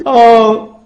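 very high, loud crying-wife voice; mock wailing. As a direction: wail hammily to the husband in falsetto. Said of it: "she immediately broke down crying".

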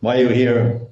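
lightly voiced policeman questioning. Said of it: "starts speaking like a policeman".

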